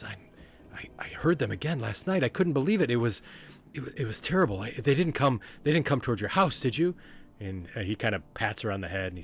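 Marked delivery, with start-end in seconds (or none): 0.0-7.0 high, loud, anxious stammering; worried little Corky. Acted. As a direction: blurt out last night's scare. Voices Corky recounting a terrible night.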